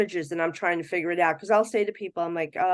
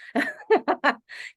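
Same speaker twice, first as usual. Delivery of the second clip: light laugh; 7 semitones higher, 3 dB louder than usual. Real, not acted. Laughing at the story.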